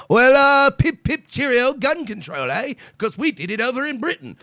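high, loud hammed-up British accent; mock British accent, pip pip cheerio. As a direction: play a pompous Brit lecturing Americans. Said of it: "You can't come over here and go".

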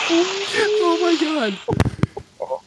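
high, wheezing laugh into drawn-out words; real laughing fit, stretched out high; cracking up. Real, not acted. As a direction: laugh helplessly through a long, high-pitched exclamation. Cracking up over trailer mention.

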